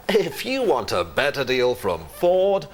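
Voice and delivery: slow advert read with a pretend chuckle; fake-amused voiceover, drawn out; laugh in the voice. Shown as a demonstration. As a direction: sell it as if amused by the sketch. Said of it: "put a sort of laugh in your voice".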